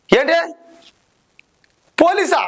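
shocked disbelief. Acted. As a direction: gasp it out as the news lands, voice jumping up. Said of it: "मस्त शेड्స ఉన్నాయి రా నీలా".

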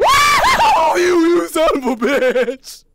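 high scream then loud cursing; scream, then curse out the monster; real startled yelling; frightened shout. Real, not acted. Jump scare, yelling.

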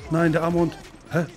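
ordinary talk

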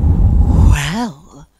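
loud, low, blustery huff; huffy wind voice, breath first. A narrator's reading. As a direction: blow the word out like an offended gust. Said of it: "huffed Wind".

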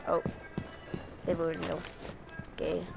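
normal talking voice

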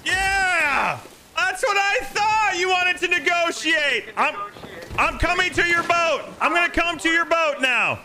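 high-pitched shout across the water; calling out. Real, not acted. Pirate player demanding negotiations from another ship, calling out. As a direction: holler triumphantly to the other boat.

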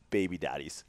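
ordinary delivery

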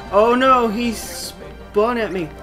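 normal talking voice

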